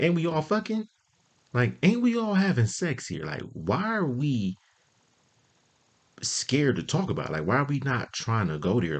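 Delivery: high-pitched, incredulous questioning; indignant protest. Retold or imitated. Comic arguing back at being told it's immature, indignant.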